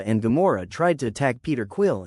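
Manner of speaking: ordinary delivery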